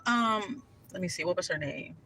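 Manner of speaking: ordinary talk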